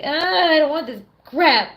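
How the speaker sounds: loud, slow, high scornful groan; mock disgusted refusal. Refusing a bad tap cider at a US bar, loud and scornful. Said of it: "And I'm like".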